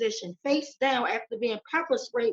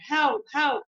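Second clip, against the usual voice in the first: higher, louder imitated cry for help; retold scream for help; 5 semitones higher, 6 dB louder than usual. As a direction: mildly re-enact a scream for help. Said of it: "He's screaming".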